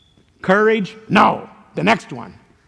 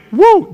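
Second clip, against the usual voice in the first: high, loud whooping cry; 9 dB louder than usual. Acted. Warning listeners before they run from their wife, loud whoop. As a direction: let out a wild whoop of alarm. Joke about using it on wife.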